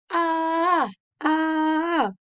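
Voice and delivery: long drawn-out high "ah"; sustained band holler, imitated; imitated band yell. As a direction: hold each yell high and steady. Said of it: "lean forward and back while yelling".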